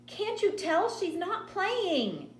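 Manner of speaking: high, slow, drawn-out wail; frantic child's plea. A narrator's reading. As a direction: implore him like a scared little girl. Frightened sister pleads with brother.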